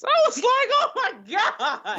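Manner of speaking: high squeal of laughter, drawn out; retold shock cracking up into helpless laughter; laughing disbelief. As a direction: burst out laughing as you exclaim. Laughing disbelief at coworker's speech.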